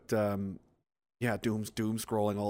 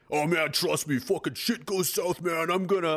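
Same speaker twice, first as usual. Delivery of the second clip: higher, sing-song swaggering wannabe; mock tough guy; 4 semitones higher than usual. Mocking a civilian who thinks he's hard, singsong bravado. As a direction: ham up the keyboard warrior's boast. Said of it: "people that think they're fucking hard".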